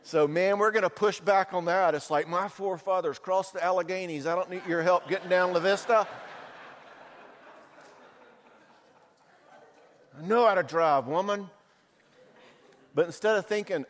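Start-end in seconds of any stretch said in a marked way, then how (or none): 2.3-11.5 mock macho husband. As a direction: puff up like a proud frontiersman. Husband asserting himself against his wife.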